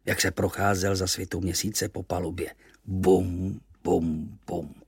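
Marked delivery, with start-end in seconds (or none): 2.8-4.7 spoken booms, each dropping away; wooden-leg thuds. A narrator's reading. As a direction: imitate slow footsteps, falling in pitch and volume. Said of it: "kroky kapitánovy dřevěné nohy". (dunivé kroky dřevěné nohy)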